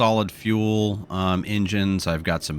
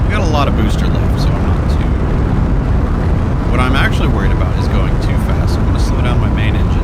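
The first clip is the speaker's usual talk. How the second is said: loud raised voice over engine roar; shouting over engine; 2 semitones higher, 7 dB louder than usual. Real, not acted. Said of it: "I know I'm yelling over the, uh, the engine".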